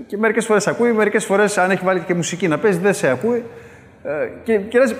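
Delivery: normal talking voice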